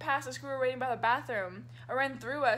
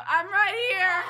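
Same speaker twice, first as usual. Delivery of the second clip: high, loud shout ending in laughter; calling out; 7 semitones higher, 9 dB louder, slower than usual. Retold or imitated. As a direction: call out to be noticed, amused. Retold calling out to announcer.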